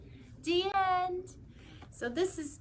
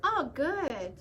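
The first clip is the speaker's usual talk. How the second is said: plain reading voice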